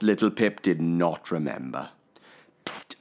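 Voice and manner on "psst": unvoiced hushed psst; whispered psst. A narrator's reading. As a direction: hiss a quiet psst to wake him. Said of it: "said Little Pip".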